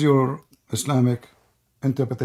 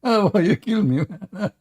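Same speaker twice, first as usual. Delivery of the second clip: high-pitched, sinking as the breath goes; laughing hard; 7 semitones higher than usual. Real, not acted. Host to the departed opponent, laughing hard. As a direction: gasp it out through laughter.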